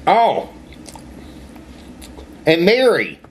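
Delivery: chewing while talking; muffled by food in mouth; mouth full. Real, not acted. Seen 0: Bites food at -3 to -1, then speaks while chewing, holding food.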